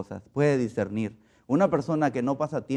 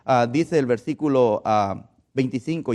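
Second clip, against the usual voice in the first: ordinary talk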